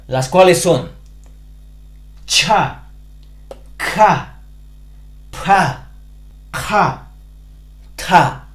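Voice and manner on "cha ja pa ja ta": each of "cha", "ja", "pa", "ja", "ta" said with a strong puff of breath; aspirated consonants. Shown as a demonstration. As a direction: push air out hard behind each consonant. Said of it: "pronunciación aspirada". (consonantes aspiradas con soplo de aire)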